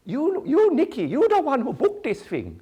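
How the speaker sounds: high, loud broken-English accusation; dad turning the blame on his daughter; accusing father. Retold or imitated.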